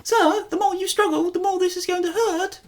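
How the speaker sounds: very high-pitched British voice; high British accent. Shown as a demonstration. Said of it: "do all British people speak high".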